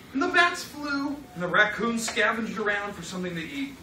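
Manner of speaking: ordinary delivery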